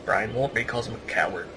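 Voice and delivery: normal talking voice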